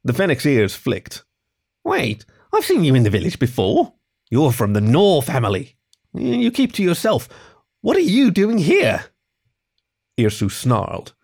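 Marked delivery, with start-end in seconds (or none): 1.8-9.0 puzzled fennec. Acted. Fennec questions the cheetah.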